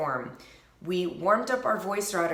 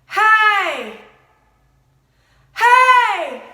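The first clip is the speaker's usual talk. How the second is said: each call higher and louder; rising calls; 11 semitones higher, 11 dB louder, much slower than usual. Shown as a demonstration. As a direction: climb up to a shout. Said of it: "I'm gonna go a little bit higher each time".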